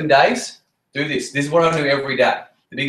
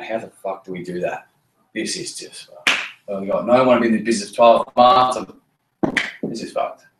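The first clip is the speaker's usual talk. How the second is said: hushed, panicked mutter under the breath; scared whisper; 4 semitones lower than usual. Retold or imitated. New recruit whispering in panic about the business. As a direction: whisper it like you're scared stiff.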